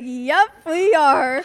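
high, slow, resigned groan; drawn-out doomed agreement; deadpan dread. Retold or imitated. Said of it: "I was like".

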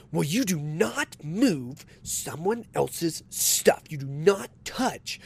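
slow, deliberate, each word spelled out; imitating the woman's preachy rule; mock stern scolding. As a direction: lecture like a strict schoolteacher. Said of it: "and she goes".

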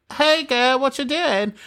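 high, mock British girl voice; bad British accent. Podcast host mimics her daughter's British accent, high and hammy. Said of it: "my British accent is worse".

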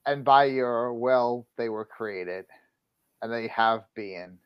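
low, quiet, slow Bible reading; hushed, measured recitation; scripture reading. Acted. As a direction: read the verse softly and solemnly. Reads Bible verse aloud.

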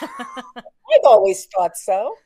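slow, laughing reply. Real, not acted. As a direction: say it through a laugh. Laughing at gravity joke.

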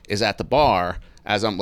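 normal talking voice

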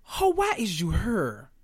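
lightly voiced sassy quote; quoting Jocelyn. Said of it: "In the words of the goat".

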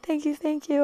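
high, soft repeated thanks; sweet retold gush. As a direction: imitate flattered people thanking a fan. Acts out TikTokers thanking her.

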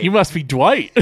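gushing, mock-starstruck greeting; imitated excitement. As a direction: act out a thrilled first meeting. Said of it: "He's so excited".

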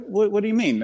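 high-pitched puzzled retelling; acted bewilderment. Driver to head-shaking strangers, baffled. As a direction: replay being thrown, pitched high.

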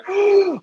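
sharp, high shocked gasp; a mother seeing her son's face; horrified gasp. Acted. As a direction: gasp in horror.

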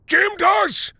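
sudden high-pitched shout; old man shouting suddenly awake. A narrator's reading.

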